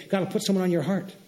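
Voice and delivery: ordinary talk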